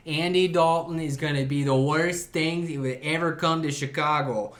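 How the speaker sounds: a hint of a Chicago South Side caller; lightly voiced angry Chicago fan; a caller from the South Side griping about the Bears, mild Chicago accent. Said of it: "you can hear the Italian beef pouring out of their mouths".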